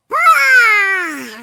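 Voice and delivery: drawn-out loud "aah"; long loud wail. Pretend. Player exclaims after leaving the family, long loud wail. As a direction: let out one long, crying shout.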